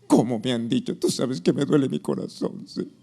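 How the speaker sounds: mock wounded believer praying; half-hearted plaintive prayer; hurt prayer. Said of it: "esa es la oración".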